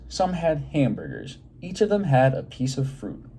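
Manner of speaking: lively, expressive read-aloud for a class; reading to children. Acted. Teacher reading a picture book aloud to her class, lively and expressive. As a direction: draw the kids into the story.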